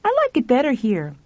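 high, light character voice. Acted. Someone reassuring a friend in a light high voice.

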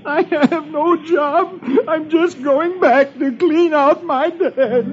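high, very slow blubbering; tearful comic wail. Acted. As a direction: drag it out in mock misery. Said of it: "tears streaming down his face".

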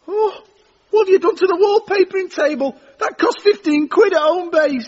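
high, tearful dismay; dismayed wail. Acted. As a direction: wail over your broken property. Discovering his smashed table.

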